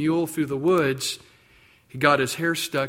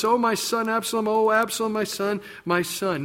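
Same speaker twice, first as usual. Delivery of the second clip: grieving cry; 6 semitones higher than usual. Retold or imitated. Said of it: "cried out with a loud voice".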